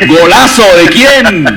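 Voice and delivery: slow, high and loud golazo call; excited shout. Real, not acted. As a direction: stretch it out like a stadium announcer. Host reacts to caller's goal call. (grito emocionado de golazo)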